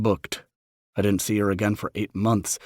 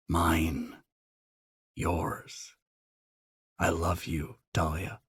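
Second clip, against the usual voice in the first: soft, hushed vow; tender whisper; 2 semitones lower, 6 dB quieter, much slower than usual. A narrator's reading.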